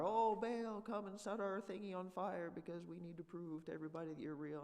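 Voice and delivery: high, hammed-up pleading cry to Baal; mock Baal priests. Pastor mimics the priests of Baal, high mock wailing. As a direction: ham up the frantic priests begging their god.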